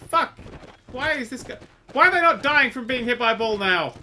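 loud, high, outraged complaint; exasperated shout. Real, not acted. Player ranting at enemies who won't die, exasperated shout. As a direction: yell the question in disbelief. Enemies not dying, frustrated.

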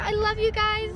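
normal talking voice

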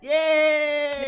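long, loud, high yay; drawn-out celebratory shout; excited cheer. Real, not acted. Cheering the reunited siblings.

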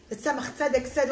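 ordinary delivery, nothing marked

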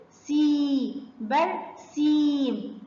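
"سي" held, then "برسيم" with long ee; slow phonics demo, "برسيم" in two beats; long vowel. Shown as a demonstration. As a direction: stretch the ya in "سي" and "برسيم". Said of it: "سامعين صوت المد بالياء مع السين".

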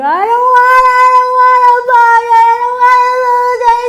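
very high, very loud sing-song whine; shrieking toddler refusal, hammed-up; whining child. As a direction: wail like a stubborn kid. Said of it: "the child's gonna go".

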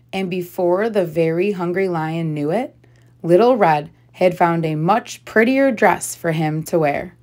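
plain reading voice

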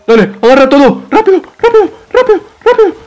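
high, rushed urging; frantic hurry. Real, not acted. Player rushing to grab items, high frantic urging. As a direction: hurry yourself along in a panic.

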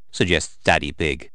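plain reading voice

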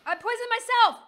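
loud, high exclamation; shouted in high-pitched alarm; dismayed shout. Real, not acted. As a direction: cry out at your own blunder. Realizes a blunder in the game.

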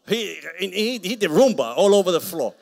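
high, loud, laughing pulpit shout; roaring, laughing preacher cry; shouted preaching. Real, not acted. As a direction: shout it out over the crowd. Preacher shouting comic story.

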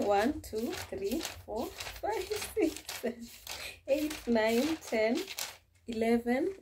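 giggly rising count; excited counting. Real, not acted. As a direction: pitch climbs high as the count runs on, laughing. Counting notes pages with amusement.

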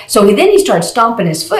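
ordinary delivery

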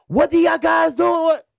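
high, loud scolding shout; high angry yell; lady scolding. Retold or imitated. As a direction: yell at the kids. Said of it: "the lady is on them now".

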